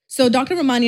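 usual speaking voice

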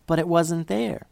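usual speaking voice

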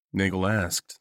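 ordinary delivery, nothing marked

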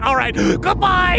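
high, drawn-out farewell; sing-song goodbye up high; departing spirit. Acted. Spirit takes leave of Albie, high-voiced.

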